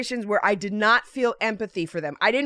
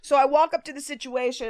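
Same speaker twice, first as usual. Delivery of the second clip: usual speaking voice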